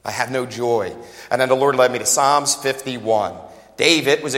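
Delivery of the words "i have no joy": quiet, drained and joyless; a worn-out pastor confessing his misery; miserable. Retold or imitated. Pastor retelling his misery, quiet and drained. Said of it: "and I'm like".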